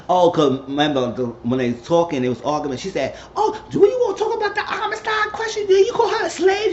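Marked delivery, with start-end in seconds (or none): 3.4-6.7 very high mock accusation; argumentative questioning. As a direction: imitate someone demanding answers in a row, pitched way up.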